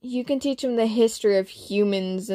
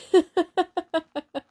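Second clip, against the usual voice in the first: a real high burst of laughter; 9 semitones higher than usual. Real, not acted. Reader cracks up at her own story, high laughter. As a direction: crack up at the silly scene. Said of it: "I just kept laughing".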